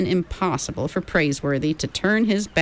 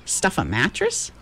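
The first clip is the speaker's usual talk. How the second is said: ordinary delivery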